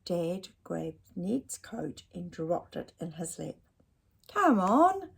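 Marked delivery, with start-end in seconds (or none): 4.3-5.1 higher, louder upbeat call; cheery rally. Acted. Dad rouses Ned to go out, upbeat and loud. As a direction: rouse the boy. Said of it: "he said".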